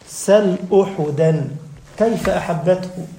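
slow chanted devotional verse; slow recited praise poem; poem recitation. Acted. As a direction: draw each line out lovingly.

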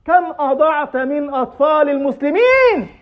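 high, strained lament, slowing on the repeat; weeping lament. Acted. Umar weeping over the children he failed, anguished. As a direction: cry out in remorse. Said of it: "فبكى عمر وقال".

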